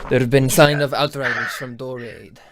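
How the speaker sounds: loud, formal court advisor report. Acted. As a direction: announce it to the lord, loud and formal.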